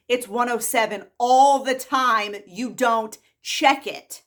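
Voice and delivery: loud, slow, hammered-out words; exasperated rebuke. Real, not acted. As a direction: drive each word home, loud and drawn out.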